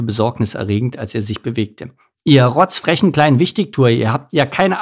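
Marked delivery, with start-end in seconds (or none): 2.2-4.8 louder, higher angry telling-off; loud, cross scolding; indignant rebuke. A narrator's reading. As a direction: lay into the cheeky kids, voice raised. Mike rebukes the cheeky children.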